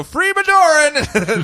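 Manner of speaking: high, slow yell through a laugh; mock battle cry. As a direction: call it out the window like a joke. Yelled out window, laughing.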